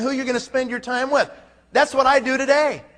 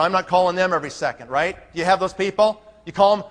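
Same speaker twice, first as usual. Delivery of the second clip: normal talking voice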